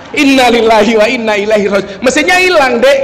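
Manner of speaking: imitated shout of alarm. Madurese friend shouts that the engine is gone, mock alarmed yell. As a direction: cry out in mock dismay, pitch climbing at the end. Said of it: "Langsung dia teriak".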